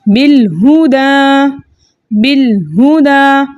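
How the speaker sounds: slow "बिलहुदा" with a straight, plain बि, not बेल; recitation demo. Shown as a demonstration. Teacher models the word for students, slow even chant. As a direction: chant "बिलहुदा" slowly and evenly, twice. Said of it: "बिल्कुल सीधा रख के पढ़ना".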